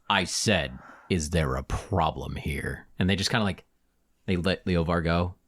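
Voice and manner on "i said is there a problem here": low, slow and controlled; quietly looming bouncer; menacing Minotaur. Acted. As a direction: repeat it with deep, deliberate threat. Minotaur repeats his challenge.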